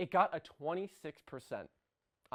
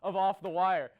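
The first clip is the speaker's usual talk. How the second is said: spooky host greeting; 4 semitones higher than usual. Acted. Host opening a spooky Halloween edition, theatrical welcome. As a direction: draw out spooky like a horror-show host.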